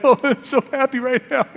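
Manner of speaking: high blubbering laughing voice; hammed-up happy sob; mock crying friend. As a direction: imitate a grown man weeping for joy. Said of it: "crying like a baby".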